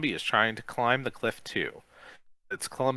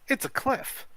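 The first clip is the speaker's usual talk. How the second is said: very high squeal of disbelief; incredulous cry. A narrator's reading. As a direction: protest it's impossible. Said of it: "she cries".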